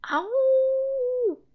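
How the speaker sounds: high, drawn-out monster howl; monster roar. Acted. Monster bursting in on the boy, roaring. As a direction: roar like a giant beast.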